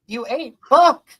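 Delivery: hammed-up horrified parent; mock shocked parents. Parents accuse zombie son.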